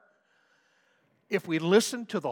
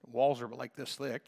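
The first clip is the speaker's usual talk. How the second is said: normal talking voice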